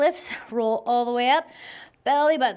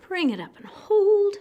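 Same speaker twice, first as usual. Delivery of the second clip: higher and louder, straining with effort; strained call; 7 semitones higher, 4 dB louder than usual. Real, not acted. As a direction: push the cue out through the effort. Repeated balance cue with effort.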